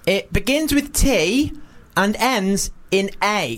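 imitated child, very slow clue; four-year-old girl. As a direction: spell out the clue slowly like a small child. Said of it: "she's about four or something".

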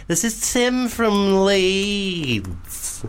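high, very slow fake Leeds accent; camp Leeds accent. Host signs off as Tim, drawn-out camp Leeds accent. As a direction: stretch out a hammed-up Leeds sign-off. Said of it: "should we do a Leeds accent".

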